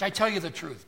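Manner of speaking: lightly hammed "I tell you the truth" like a dealer closing a sale; used car salesman. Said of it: "Used car salesman will tell you".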